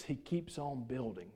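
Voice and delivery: ordinary talk, nothing marked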